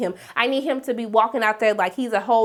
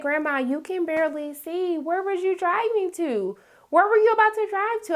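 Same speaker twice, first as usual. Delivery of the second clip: high, rising mock disbelief; a high, amused "where was you driving to"; incredulous teasing; 7 semitones higher than usual. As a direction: tease Grandma about her driving. Teasing question to Grandma character.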